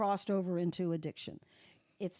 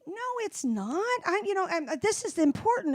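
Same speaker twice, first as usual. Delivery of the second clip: higher, hammed-up complaining; mock indignant, pitched up; mock whiny protest; 10 semitones higher, 5 dB louder than usual. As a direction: imitate your old self whining in protest. Retold own protest, mocking.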